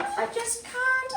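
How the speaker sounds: very high, slow wail; cling on and wail helplessly; high, drawn-out frightened plea; scared wail. A narrator's reading. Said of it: "But Kevin was scared".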